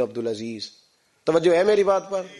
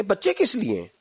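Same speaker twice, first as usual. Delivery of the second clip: much higher, indignant scolding; angry father; 9 semitones higher than usual. Acted. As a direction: scold the absent daughter in rising anger. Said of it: "کو غصہ آیا". (غصے میں ڈانٹتا ہوا باپ)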